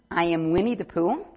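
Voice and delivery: ordinary delivery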